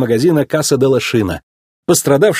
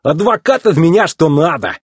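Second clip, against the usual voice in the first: higher-pitched proud self-praise; self-satisfied boast; 6 semitones higher than usual. A narrator's reading. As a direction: praise yourself happily. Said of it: "похвалил себя мэтр".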